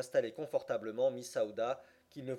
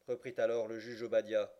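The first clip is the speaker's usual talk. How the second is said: usual speaking voice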